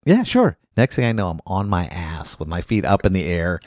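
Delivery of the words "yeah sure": much higher, breezy yes; eager yes. Retold or imitated. Guest agreeing to a wrestler's bump, breezy and high. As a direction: toss it off high and carefree. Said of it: "I'm like".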